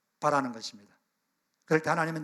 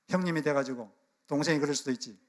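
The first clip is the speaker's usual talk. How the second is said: ordinary talk, nothing marked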